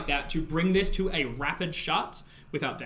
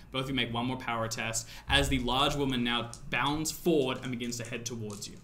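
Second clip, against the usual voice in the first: ordinary delivery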